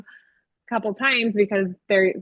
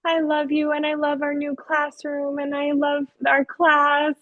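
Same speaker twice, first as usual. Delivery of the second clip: higher-pitched imitation of a tender child; sensitive little pupil; 6 semitones higher than usual. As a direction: voice a sensitive little kid, sustained and high. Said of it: "really, really sensitive".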